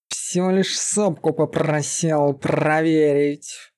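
exasperated beast-man; irritated bark. A narrator's reading. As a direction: growl it in annoyance, rolling the r. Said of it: "раздражённо рявкнул он".

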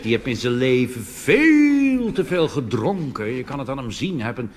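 ordinary delivery

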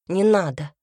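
loud, abrupt refusal; sharp cutting-off. A narrator's reading. Woman pulling her hand away from him, sharp and loud. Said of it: "оборвала она его резко".

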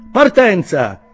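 high, loud departure call; shout. A narrator's reading. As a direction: holler the signal to set off. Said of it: "gridò lo stalliere". (grido di partenza)

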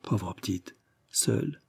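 beaucoup plus doux, brisé de sanglots retenus; à travers les sanglots. A narrator's reading. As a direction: presque étouffé par les larmes. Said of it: "à travers ses sanglots". (à travers les sanglots, très doux)

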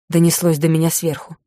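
ordinary delivery

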